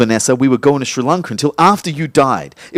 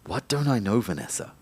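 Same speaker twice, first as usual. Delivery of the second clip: hushed; 5 semitones lower, 12 dB quieter than usual. Acted. Ghost asks Vanessa, unheard.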